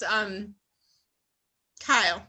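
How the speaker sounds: ordinary talk, nothing marked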